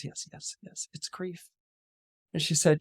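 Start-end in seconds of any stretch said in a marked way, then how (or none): 0.0-1.5 very soft, near-whispered yes; grieving assent. Acted. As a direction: barely voice it, a mother admitting grief. Grieving queen admits her feeling.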